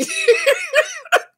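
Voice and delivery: loud burst of laughter; high laugh. Real, not acted. Laughing at Liza's message.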